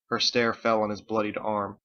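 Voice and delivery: ordinary delivery, nothing marked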